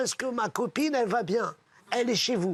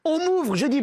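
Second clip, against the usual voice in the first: ordinary talk, nothing marked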